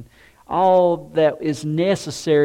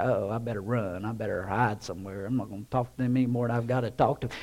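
softer, lower, hurried nervous mutter; nervous aside; 7 semitones lower, 9 dB quieter, much faster than usual. Retold or imitated. Someone avoiding a grouchy person, hushed and hurried. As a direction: duck away from a grouch under your breath.

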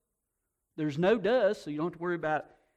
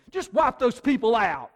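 Jonah's demand; 4 semitones higher, 8 dB louder than usual. Retold or imitated. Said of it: "this prophet of God, Jonah".

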